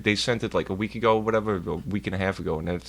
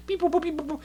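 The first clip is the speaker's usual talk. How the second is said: dial tones; faster than usual. Retold or imitated. Imitating phone dialing.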